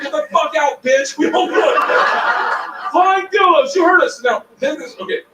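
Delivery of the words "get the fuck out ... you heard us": high-pitched mock jeer; booing heckler. Rowdy crowd booing a girl off stage, mock heckling. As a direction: yell her off the stage, pitched up. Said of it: "we boo her off stage".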